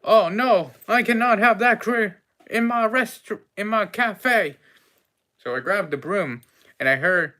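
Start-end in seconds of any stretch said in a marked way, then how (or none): 0.0-4.6 loud, high cry of dismay; high, raised, indignant alarm; dismayed outburst. Acted. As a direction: protest loudly, voice pitched up. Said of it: "I'm like".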